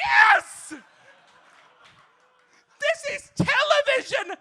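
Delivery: high, loud laughing yells; ecstatic shouting. Real, not acted. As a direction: scream your joy at the screen. Fan losing composure.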